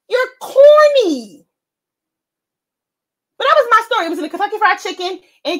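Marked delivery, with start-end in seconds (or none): 0.1-1.4 loud, high, drawn-out taunt; mocking put-down. Humbling a wannabe gangster, loud mocking taunt. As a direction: stretch the insult out, pitched high and loud.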